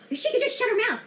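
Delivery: high, loud mock outrage; mocking critics. Activist mocks angry critics, high loud sneer. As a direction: throw their complaint back at them, sneering. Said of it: "They're like".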